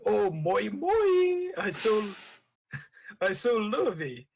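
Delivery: high, squeaky, over-the-top comic voice; Jar Jar. Acted. Reader voices the clown to Qui-Gon, high goofy gushing. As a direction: gush it with a sudden high swoop. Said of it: "use my Jar Jar voice".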